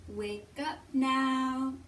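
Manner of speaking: ordinary talk, nothing marked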